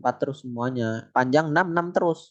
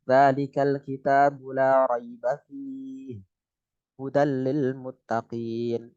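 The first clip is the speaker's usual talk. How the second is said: slow recitation, pause after fihi; recite, stopping at the other sign; recited with pause; much slower than usual. Shown as a demonstration. Teacher showing the alternative stop point, slow recitation. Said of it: "bisa fi".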